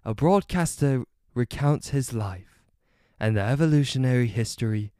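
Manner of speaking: reading in a put-on British accent; mock British documentary read. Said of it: "I should read it in a British accent".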